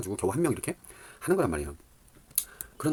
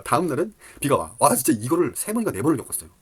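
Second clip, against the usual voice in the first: exasperated rant; 5 semitones higher, 7 dB louder than usual. Real, not acted. Ranting about bad weather timing.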